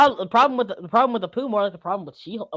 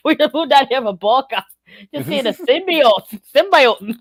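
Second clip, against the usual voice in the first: hammed-up Midwestern chatter; exaggerated Midwestern vowels; Midwestern accent; 3 semitones higher, 3 dB louder than usual. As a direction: play a folksy Midwesterner marvelling at things. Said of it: "like, Midwestern Venom".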